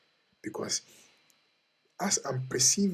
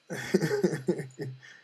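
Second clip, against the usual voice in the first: rapid, higher-pitched chuckle; amused chuckle; 4 semitones higher, 4 dB louder than usual. Real, not acted. Speaker amused after asking listeners who they are, chuckling. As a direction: chuckle in a quick run of pulses.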